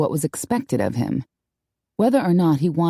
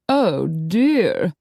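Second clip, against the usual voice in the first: helpless lady. A narrator's reading. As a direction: play helpless and coy. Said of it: "looking helpless".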